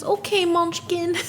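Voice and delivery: put-on high, sweet mom with a Spanish accent. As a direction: coo it like a doting mom, Spanish lilt. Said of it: "And she goes".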